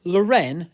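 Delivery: Lauren stressed on the second syllable, luh-REN; wrong pronunciation. Shown as a demonstration. Co-host showing the mistake, Lauren said luh-REN. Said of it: "it's not Ralph Lauren".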